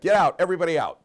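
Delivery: loud, high shouted order; yelling. Acted. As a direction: yell to clear the whole room. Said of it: "He yells".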